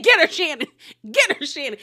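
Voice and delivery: high, loud mock cheer; gleeful egging-on. Viewer egging Shannon on after the snub, gleeful. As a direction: cheer her on, tickled and high-pitched.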